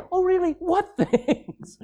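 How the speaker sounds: high, laughing mock surprise; amused dad. Dad gently teasing his moping teenager, laughing and high. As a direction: tease it like a dad who knows better. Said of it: "And what does Dad say?".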